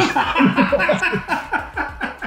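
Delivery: high, sustained laughing fit; real laughter. Real, not acted. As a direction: crack up at his own oversight. Realizes he never saved it.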